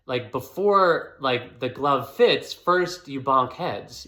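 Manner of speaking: ordinary talk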